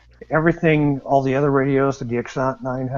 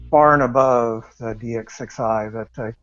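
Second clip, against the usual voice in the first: normal talking voice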